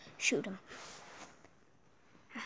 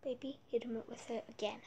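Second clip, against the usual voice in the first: fast hushed whisper; 13 dB quieter, faster than usual. Acted. Urging unit quietly.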